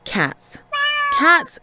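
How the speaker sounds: plain reading voice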